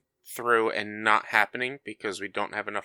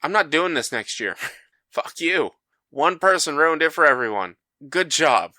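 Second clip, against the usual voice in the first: mock fed-up outburst; 5 semitones higher, 3 dB louder than usual. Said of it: "And I was like".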